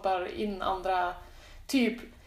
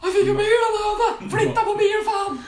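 loud, high-pitched shout in Sundsvall dialect; Sundsvall accent; 11 semitones higher, 10 dB louder than usual. Acted. Host parodies Norrland's whine belt, shouting in Sundsvall accent. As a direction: yell it in broad Sundsvall dialect. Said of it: "eller snackar vi Sundsvall".

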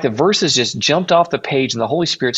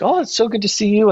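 high, bright-eyed mock gush over an old friend; imitating an overjoyed church lady; gushing greeting; 9 semitones higher than usual. Church lady spotting him in the back, imitated gushing.

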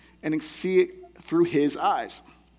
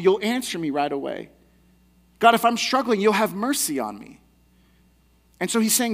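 ordinary talk

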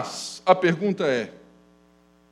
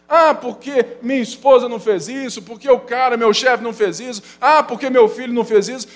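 mock whiny grumbling, a bit higher and louder; whiny complaining; 5 semitones higher, 5 dB louder than usual. Preacher mimicking a grumbler blaming wife, boss, son, in a whiny voice. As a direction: whine like someone blaming everyone else. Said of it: "você só reclama do outro".

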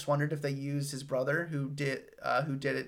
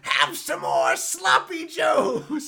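put-on high, slow shouted old lady; lunch lady voice; 13 semitones higher, 7 dB louder, slower than usual. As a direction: bellow it like a cranky lunch lady. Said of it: "an old lunch lady voice".

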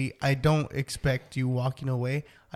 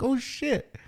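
surprised; 10 semitones higher than usual. Retold or imitated. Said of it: "I was like".

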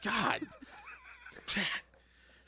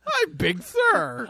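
mock baby talk; 8 semitones higher, much slower than usual.

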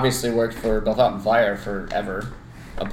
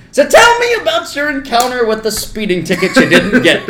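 loud, raised voice of a father who knows; confronting dad; 10 semitones higher, 5 dB louder than usual. Retold or imitated. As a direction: imitate a dad calling out his son. Retold dad confronts son.

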